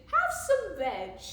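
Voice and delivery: storybook hooting call; hooted offer. A narrator's reading. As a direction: hoot it out as a welcome. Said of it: "they hooted".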